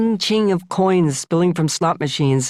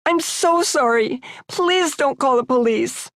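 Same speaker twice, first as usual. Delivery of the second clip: tearful pleading; 9 semitones higher than usual. Acted. Crying confession continues.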